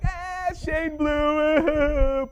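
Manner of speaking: high drawn-out taunt; sing-song teasing, slow; mocking taunt. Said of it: "kids were like".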